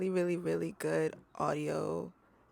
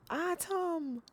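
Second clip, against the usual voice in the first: imitating a Jamaican mother, high-pitched "Autumn"; mama's Jamaican accent; 11 semitones higher than usual. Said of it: "'Cause she's Caribbean, she's Jamaican".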